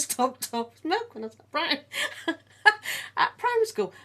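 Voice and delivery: laughing through the words. Real, not acted. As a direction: giggle while telling it.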